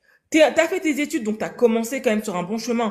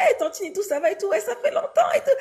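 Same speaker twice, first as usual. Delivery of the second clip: high, bubbly mock-cheerful greeting; fausse joie au téléphone; 10 semitones higher, faster than usual. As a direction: overplay the happy phone greeting. Elle-même, joyeuse au téléphone. (salutation enjouée exagérée)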